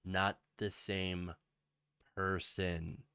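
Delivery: slow, low and soft; slow, deliberate. Real, not acted. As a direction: spell it out word by word, very slowly. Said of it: "let me just say it slowly".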